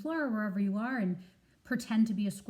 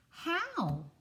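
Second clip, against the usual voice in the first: eager squirrel; 9 semitones higher than usual. Acted. Jules asks eagerly.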